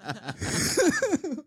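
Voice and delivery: a sudden high-pitched burst of laughter; giggling high and bright. Real, not acted. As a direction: squeal out a laugh. Reacting to the DJ's refusal.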